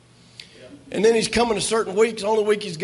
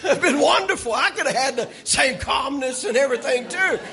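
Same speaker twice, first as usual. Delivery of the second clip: laughing it out, pitched higher; 3 semitones higher than usual. Real, not acted. Pastor laughing at himself before the congregation. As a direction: talk through real laughter. Joking about the late news.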